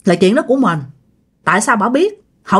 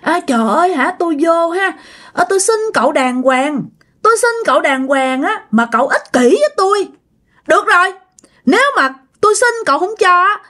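put-on high young woman's voice turning hostile; fierce young woman; 7 semitones higher than usual. Refused woman turns on the selfish young man, high and hostile. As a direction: accuse him, the sweetness gone sour. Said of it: "Cái mặt bả hung dữ lên".

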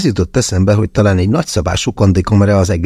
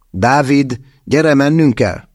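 higher urgent call; 6 semitones higher, slower than usual. A narrator's reading. Narrator urges David to come, higher call.

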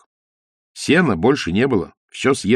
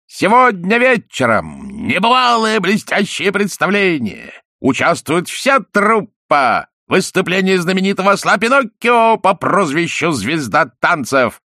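booming circus announcer; 7 semitones higher, 3 dB louder, slower than usual. A narrator's reading. Circus poster read out.

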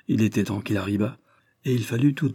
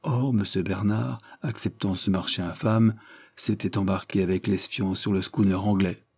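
ordinary delivery, nothing marked

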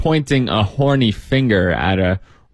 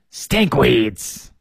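sharp. Acted. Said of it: "said the other old woman sharply".

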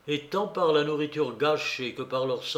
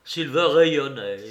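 ordinary delivery, nothing marked

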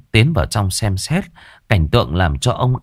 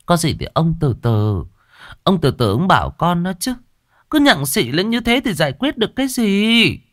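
wife calming her husband; 10 semitones higher than usual. A narrator's reading. Wife urges her angry husband to calm down, pleading. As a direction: soothe an angry husband, voice raised high. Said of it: "bà đặt cái rổ xuống".

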